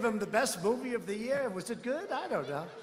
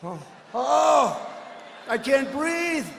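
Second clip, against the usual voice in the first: gasping, drawn-out mock panic; hammed-up can't-breathe gasps; mock choking Bloomberg; 2 semitones higher, much slower than usual. Mocking a rival who choked on the debate stage. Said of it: "He's going".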